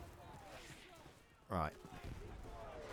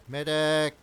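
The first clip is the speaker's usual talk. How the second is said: loud, higher call for a medic; man down shout; 5 semitones higher, 7 dB louder than usual. Real, not acted. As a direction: yell for help across the field. Wounded, calling for a medic.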